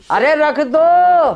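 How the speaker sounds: high, slow, loud hang up; drawn-out yell. Acted. Wife on the phone yelling long to hang up.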